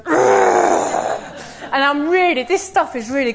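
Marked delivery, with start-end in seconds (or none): 0.0-1.4 low, guttural groan of annoyance; deep frustrated groan in her head; inner groan. Retold or imitated. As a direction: let out a deep exasperated ugh. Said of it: "inside I'm going".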